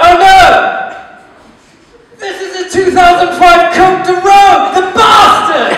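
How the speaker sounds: loud outraged mock shout; mock outrage. Imagined wino shouting over a fancy bottle, loud mock outrage. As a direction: yell it like a posh wino who has spotted a vintage bottle.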